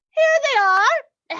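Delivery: high, loud and drawn-out discovery; delighted exclamation. Acted. Flustered lady finds her glasses, delighted exclamation. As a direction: sing out in triumph, stretching it.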